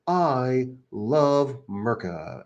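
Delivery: very slow, drawn-out patriot parody; put-on voice, dragging the words out; assumed voice, mock. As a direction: stretch every word in a mock declaration. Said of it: "I just assume that he talks that way".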